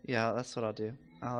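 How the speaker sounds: ordinary delivery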